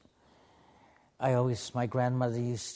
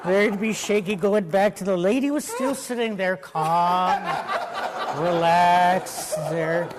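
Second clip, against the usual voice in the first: loud, higher, laughing as you tell it; laughing storyteller; 7 semitones higher, 9 dB louder than usual. Real, not acted. Laughing while telling her funny story.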